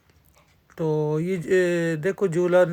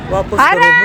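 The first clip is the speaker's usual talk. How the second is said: retold shout; 7 dB louder, slower than usual. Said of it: "شور ڈالا ہوا ہے کہ میں".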